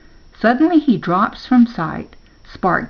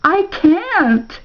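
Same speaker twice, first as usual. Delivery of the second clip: very high, wailing cry; anguished howl; 11 semitones higher, 4 dB louder than usual. A narrator's reading. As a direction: howl it out in despair. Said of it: "throws his head back and howls".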